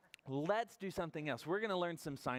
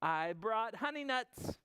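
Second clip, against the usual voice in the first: higher, eager badger voice; grinning badger; 8 semitones higher, slower than usual. Badger asking to share the snack, high and grinning. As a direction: offer it as a friendly storybook badger. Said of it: "Badger says with a grin".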